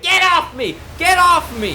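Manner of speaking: high, loud mock outburst; worked-up yelling. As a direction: yell it like someone struggling to break free. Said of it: "this man gets all worked up".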